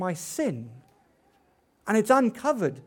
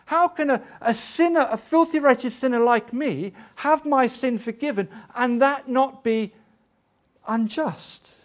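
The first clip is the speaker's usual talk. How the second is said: indignant self-reproach; 5 semitones higher, 4 dB louder than usual. Acted. Preacher building indignant self-reproach.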